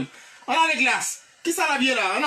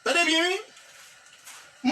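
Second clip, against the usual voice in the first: calling attention; 4 semitones higher, much slower than usual. Real, not acted. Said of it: "teacher call".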